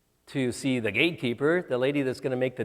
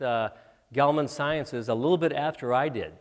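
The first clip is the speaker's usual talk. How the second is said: ordinary delivery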